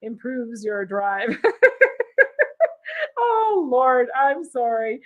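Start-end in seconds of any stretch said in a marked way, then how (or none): 1.4-3.1 high, loud real laughing fit; burst of laughter. Real, not acted. Woman reacting to her testosterone joke, high loud laughter. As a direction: crack up, pitched way up.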